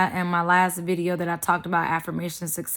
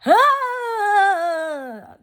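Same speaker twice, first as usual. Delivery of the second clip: gospel cry; 11 semitones higher, 4 dB louder than usual. Retold or imitated. Gospel shout after singing.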